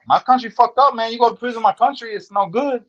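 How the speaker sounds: high, far-off mock voice of the Salvadoran inmate in broken English; imitating his accent. Retelling a cellmate's words to the host, high mock accent. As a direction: pitch it up high and foreign, like the little homie. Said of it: "that's how he talk".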